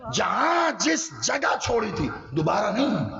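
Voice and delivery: high, slow, chanted sermon cry; preaching at full force. Acted. Preacher chanting the Prophet and Salman story, full force. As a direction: wail it out over the crowd.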